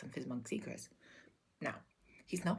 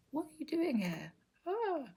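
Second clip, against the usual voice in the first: high-pitched mock fright, startled; scared monk; 12 semitones higher, 4 dB louder, slower than usual. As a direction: play a monk spooked by someone's presence. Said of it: "the monk's scared of my aura".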